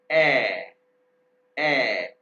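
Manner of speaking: ए sounded loud and clear; umlaut sound. Shown as a demonstration. Teacher demonstrates the umlaut vowel, loud and clear. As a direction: say the umlaut ए loudly. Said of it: "गौर से सुने".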